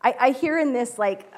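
usual speaking voice

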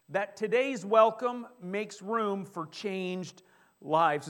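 slow, every word weighted; slow emphatic repeat. Acted. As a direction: lay it down slowly, word by word. Preacher repeats big idea.

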